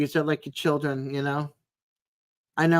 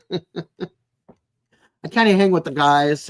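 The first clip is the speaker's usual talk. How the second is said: laughing; 5 dB louder, much slower than usual. Real, not acted. Laughing while chatting to viewers.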